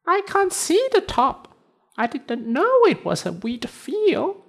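very high tiny cricket voice. Acted. Cricket answers.

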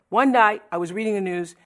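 usual speaking voice

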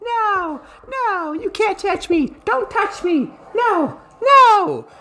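hooting mock yelps of defiance; imitating a hollering man. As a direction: ham up the panicked guy resisting arrest, slowly. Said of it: "hooting and hollering, being like".

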